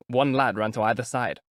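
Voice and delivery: ordinary talk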